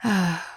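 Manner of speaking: short falling sigh. Acted. Vampire about to give partner examples, short sigh.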